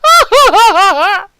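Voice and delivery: high, loud villain cackle; evil laugh. Acted. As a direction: gloat like a scheming villain. Villain plans after "won't get caught.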